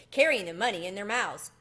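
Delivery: ordinary delivery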